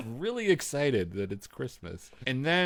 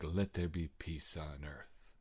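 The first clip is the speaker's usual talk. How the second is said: low, slow, soft narrator sign-off; solemn Serling-style pronouncement; 8 semitones lower, 10 dB quieter, slower than usual. Retold or imitated. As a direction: intone it gravely and quietly, like a closing moral. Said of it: "It's just a very Serling-y".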